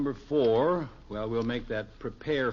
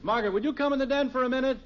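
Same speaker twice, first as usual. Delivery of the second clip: raised, quick call to another room; 11 semitones higher, 4 dB louder, faster than usual. Acted. Father summons wife to the den, raised call. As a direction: call her in, fast and loud.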